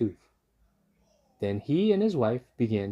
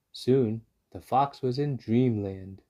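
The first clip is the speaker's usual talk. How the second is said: usual speaking voice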